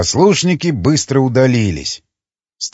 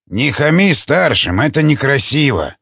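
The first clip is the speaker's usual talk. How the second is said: usual speaking voice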